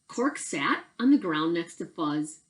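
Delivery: ordinary delivery, nothing marked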